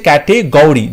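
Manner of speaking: "ক্যাটেগরি" said kat-e-GOU-ri; "ক্যাটেগরি" misread with an "ou" after g; misspelling read aloud. Shown as a demonstration. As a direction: widen the third syllable of "ক্যাটেগরি" to "gou". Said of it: "এটাও সঠিক নয়".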